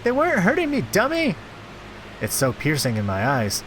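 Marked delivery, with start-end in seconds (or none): 0.0-1.4 put-on very high girlish outcry; protesting girl. Girl crying harder at Jack, high protest. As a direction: protest in a high, upset girl's voice.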